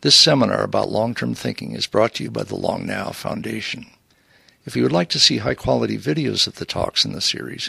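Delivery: loud, measured announcer read; announcer intro. Real, not acted. Host introducing the seminar series to listeners, announcer read. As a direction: deliver a loud programme announcement.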